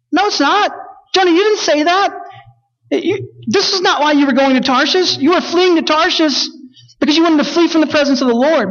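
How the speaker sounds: high, heated scolding of Jonah; indignant rebuke. Acted. Preacher confronting Jonah, indignant and high.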